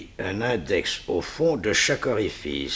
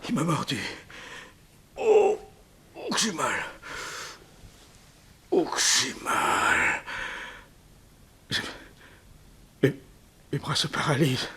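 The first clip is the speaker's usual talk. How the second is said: moaning, breathy whimper of pain; a bitten man gasping and whispering his pain; 4 semitones higher, 6 dB quieter than usual. Acted. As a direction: groan it out as the venom bites. Villain bitten by the snake.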